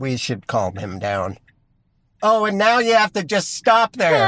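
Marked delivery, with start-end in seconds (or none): 2.2-4.3 mocking. Real, not acted. Taunting a driver from the car.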